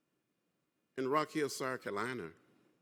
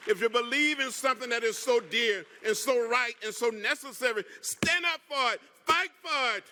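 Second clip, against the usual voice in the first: rising, louder exhortation; rousing call; 6 semitones higher, 4 dB louder than usual. Real, not acted. Congressman urging the union to stand and fight, rousing. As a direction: lift them to their feet.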